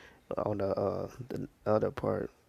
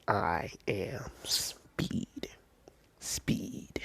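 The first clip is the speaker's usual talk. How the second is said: drawn-out whisper, zoning in; slow whispered mantra; 6 dB quieter, much slower than usual. Retold or imitated. Deku zoning in on the robots, very slow whispered mantra. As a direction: stretch each word out in a very slow, hushed whisper.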